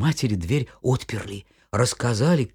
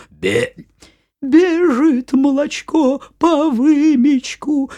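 high, slow thin singing wolf; wolf mimicking the mother goat; wolf faking sweetness; 9 dB louder, slower than usual. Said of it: "да тоненьким голосом, и запел".

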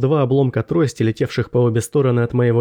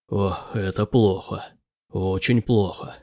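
low, soft, slow dismay; dismayed professor; 5 semitones lower, 5 dB quieter, slower than usual. A narrator's reading. Professor watches the fighters fall, low slow dismay. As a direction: say it slowly, low and subdued.

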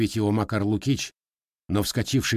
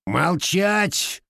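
growled; 6 semitones higher, 3 dB louder than usual. A narrator's reading. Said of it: "прорычал Лукич".

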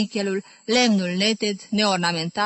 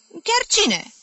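puzzled question; 13 semitones higher, 3 dB louder than usual. A narrator's reading. Dora puzzled on the phone.

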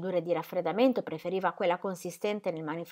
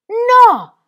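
very high, very loud No; even louder shout; 18 dB louder than usual. Acted. Ingram repeating his refusal to Ingeborg, louder shout. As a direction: bellow it harder than before. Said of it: "ribadì ancora più forte".

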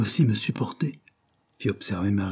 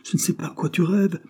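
angry; 8 semitones higher than usual. A narrator's reading. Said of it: "avec colère".

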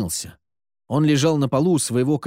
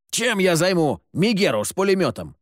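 higher-pitched indignant protest; 6 semitones higher than usual. A narrator's reading. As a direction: protest an impossible order. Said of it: "возмутился Сид". (возмущённо, на повышенных тонах)

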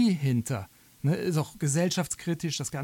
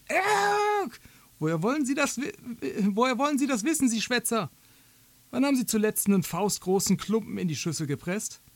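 much higher, sharp and scolding; indignant woman in labour; 6 semitones higher than usual. Acted. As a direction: snap at the doctor, outraged. Martha keift den Doktor an. (empörte, hohe Frauenstimme)

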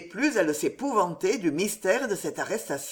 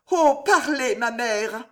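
urgent plea; 5 semitones higher, 5 dB louder, slower than usual. Acted. Daughter begs mother to speak.

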